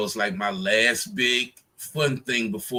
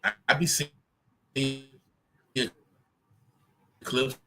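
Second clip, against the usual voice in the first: corrupted glitchy audio. Real, not acted. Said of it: "Hold on, you're breaking up".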